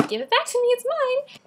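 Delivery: put-on high, loud childish demand; bratty high-pitched claim; possessive demand. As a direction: whine like a kid claiming her toy. Note written in her book.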